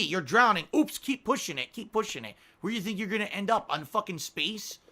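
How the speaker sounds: high, taunting escalation; mocking trolls. Streamer mocks trolls who keep pushing it, taunting.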